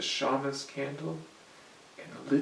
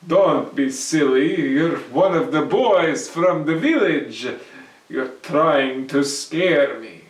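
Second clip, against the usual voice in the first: put-on louder, brash young man brushing it off; bold Herschel, scoffing; 12 dB louder than usual. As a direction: call his bluff out loud. Herschel voiced by narrator.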